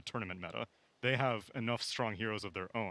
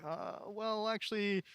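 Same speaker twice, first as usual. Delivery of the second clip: higher, hesitant second-guessing voice; a hint of a dithering drafter, pitched up; mock indecisive drafter; 7 semitones higher than usual. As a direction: waver like a team changing its mind mid-draft. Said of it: "you can't be like".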